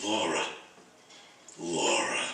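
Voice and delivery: soft, low wistful murmur. Acted. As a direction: say the name as if lost in memory. Boss recalls a past star.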